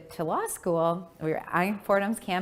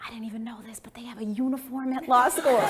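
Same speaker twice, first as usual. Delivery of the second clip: whispered, laughing confession; sharing an embarrassing discovery; hushed; 4 semitones higher than usual. Retold or imitated. Daughter whispering to mom about a law school uniform.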